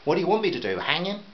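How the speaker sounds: irritated father snapping back. A narrator's reading. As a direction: throw it back at her. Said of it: "Now the father was getting irritated".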